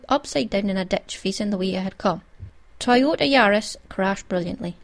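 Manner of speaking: ordinary delivery, nothing marked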